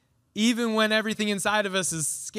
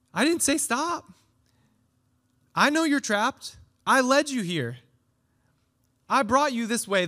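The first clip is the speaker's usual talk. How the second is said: higher and louder, firm divine reassurance; God speaking; 6 semitones higher, 4 dB louder than usual. Acted. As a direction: speak as God steadying his people. God answering Moses, voiced by preacher.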